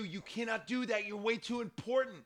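lightly voiced [name] scolding; scolding mother. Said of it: "It was stupid of you".

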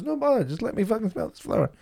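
mock kid voice, lightly delivered; little boy. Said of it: "He goes".

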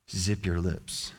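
soft, slow hush; quiet slow hush. Acted. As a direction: shush them gently. Preacher hushing grumblers.

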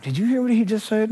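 gossiping grumbler. Acted. Grumbler to fellow listener, hushed gossipy aside. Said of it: "you're going like".

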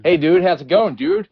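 put-on American dude voice; American accent. Shown as a demonstration. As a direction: greet like an American surfer dude. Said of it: "I can speak an American accent".

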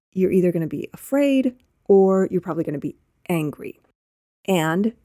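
usual speaking voice

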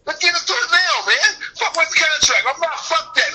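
high, heated mock challenge to fight now; playing the fighter itching to fight again right away; acted rage. Fighter shouting at rival after the bout, hyped and heated.

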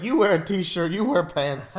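bossy designer. Retold or imitated. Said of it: "Not just like".

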